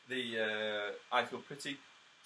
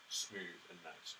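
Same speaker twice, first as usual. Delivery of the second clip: soft and slow; 6 semitones lower, 8 dB quieter, much slower than usual. Shown as a demonstration. Teacher urges smoothness.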